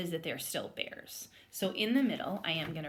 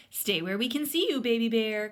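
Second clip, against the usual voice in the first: louder mother's call; 5 semitones higher, 8 dB louder than usual. Acted. Mama Bear calls to her cub in the woods, loud call. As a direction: call out to a child lagging behind. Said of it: "called Mom".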